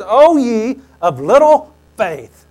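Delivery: slow, loud rebuke; O ye of little faith, drawn out; Jesus rebuking. Acted. Jesus rebuking his scared disciples, slow and loud.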